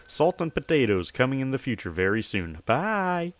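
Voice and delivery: ordinary talk, nothing marked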